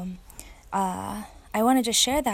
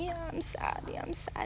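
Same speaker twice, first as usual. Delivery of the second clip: put-on high, whispered little-girl voice, softer; sad little girl; 9 semitones higher, 8 dB quieter than usual. Little girl answering her daddy, sad and whispered. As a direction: mimic a sad small child. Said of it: "she just kept saying".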